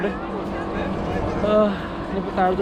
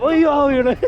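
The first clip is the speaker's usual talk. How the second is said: surprised; 7 semitones higher, 5 dB louder, slower than usual. Real, not acted. Sudden surprised exclamation.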